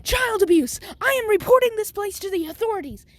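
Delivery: high, loud furious rant; mom screaming. Acted. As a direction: scream the accusation at the principal. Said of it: "she's screaming at the principal".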